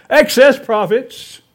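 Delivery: loud, high storybook outcry; crying out. Acted. Cow protesting to the little red hen, loud high cry. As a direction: cry it out as an outraged accusation. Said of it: "cried the cow".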